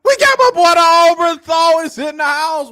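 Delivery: very high, loud, stretched-out holler; hype shout. Real, not acted. Host announcing a favorite regular, high hollered shoutout. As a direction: blast the name out like a hype man, vowels held.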